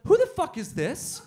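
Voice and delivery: high, loud, slow outraged yell; betrayed, screaming at the new guy; jealous shout. Retold or imitated. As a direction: spit it out in mock fury. Jealous outburst.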